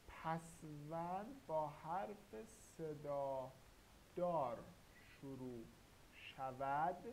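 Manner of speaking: slow dictation. Shown as a demonstration. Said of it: "teacher dictates rule slowly".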